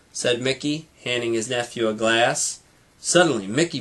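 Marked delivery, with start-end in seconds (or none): none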